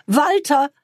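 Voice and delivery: put-on young woman calling her lover in panic; frightened cry. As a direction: call out for help, a bit alarmed. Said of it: "wurde sie leichenblass und rief".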